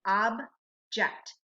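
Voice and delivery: slow "object", split ob, pause, ject; syllable by syllable. Shown as a demonstration. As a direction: sound out "object" in two separate syllables. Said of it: "What's the second syllable in object?".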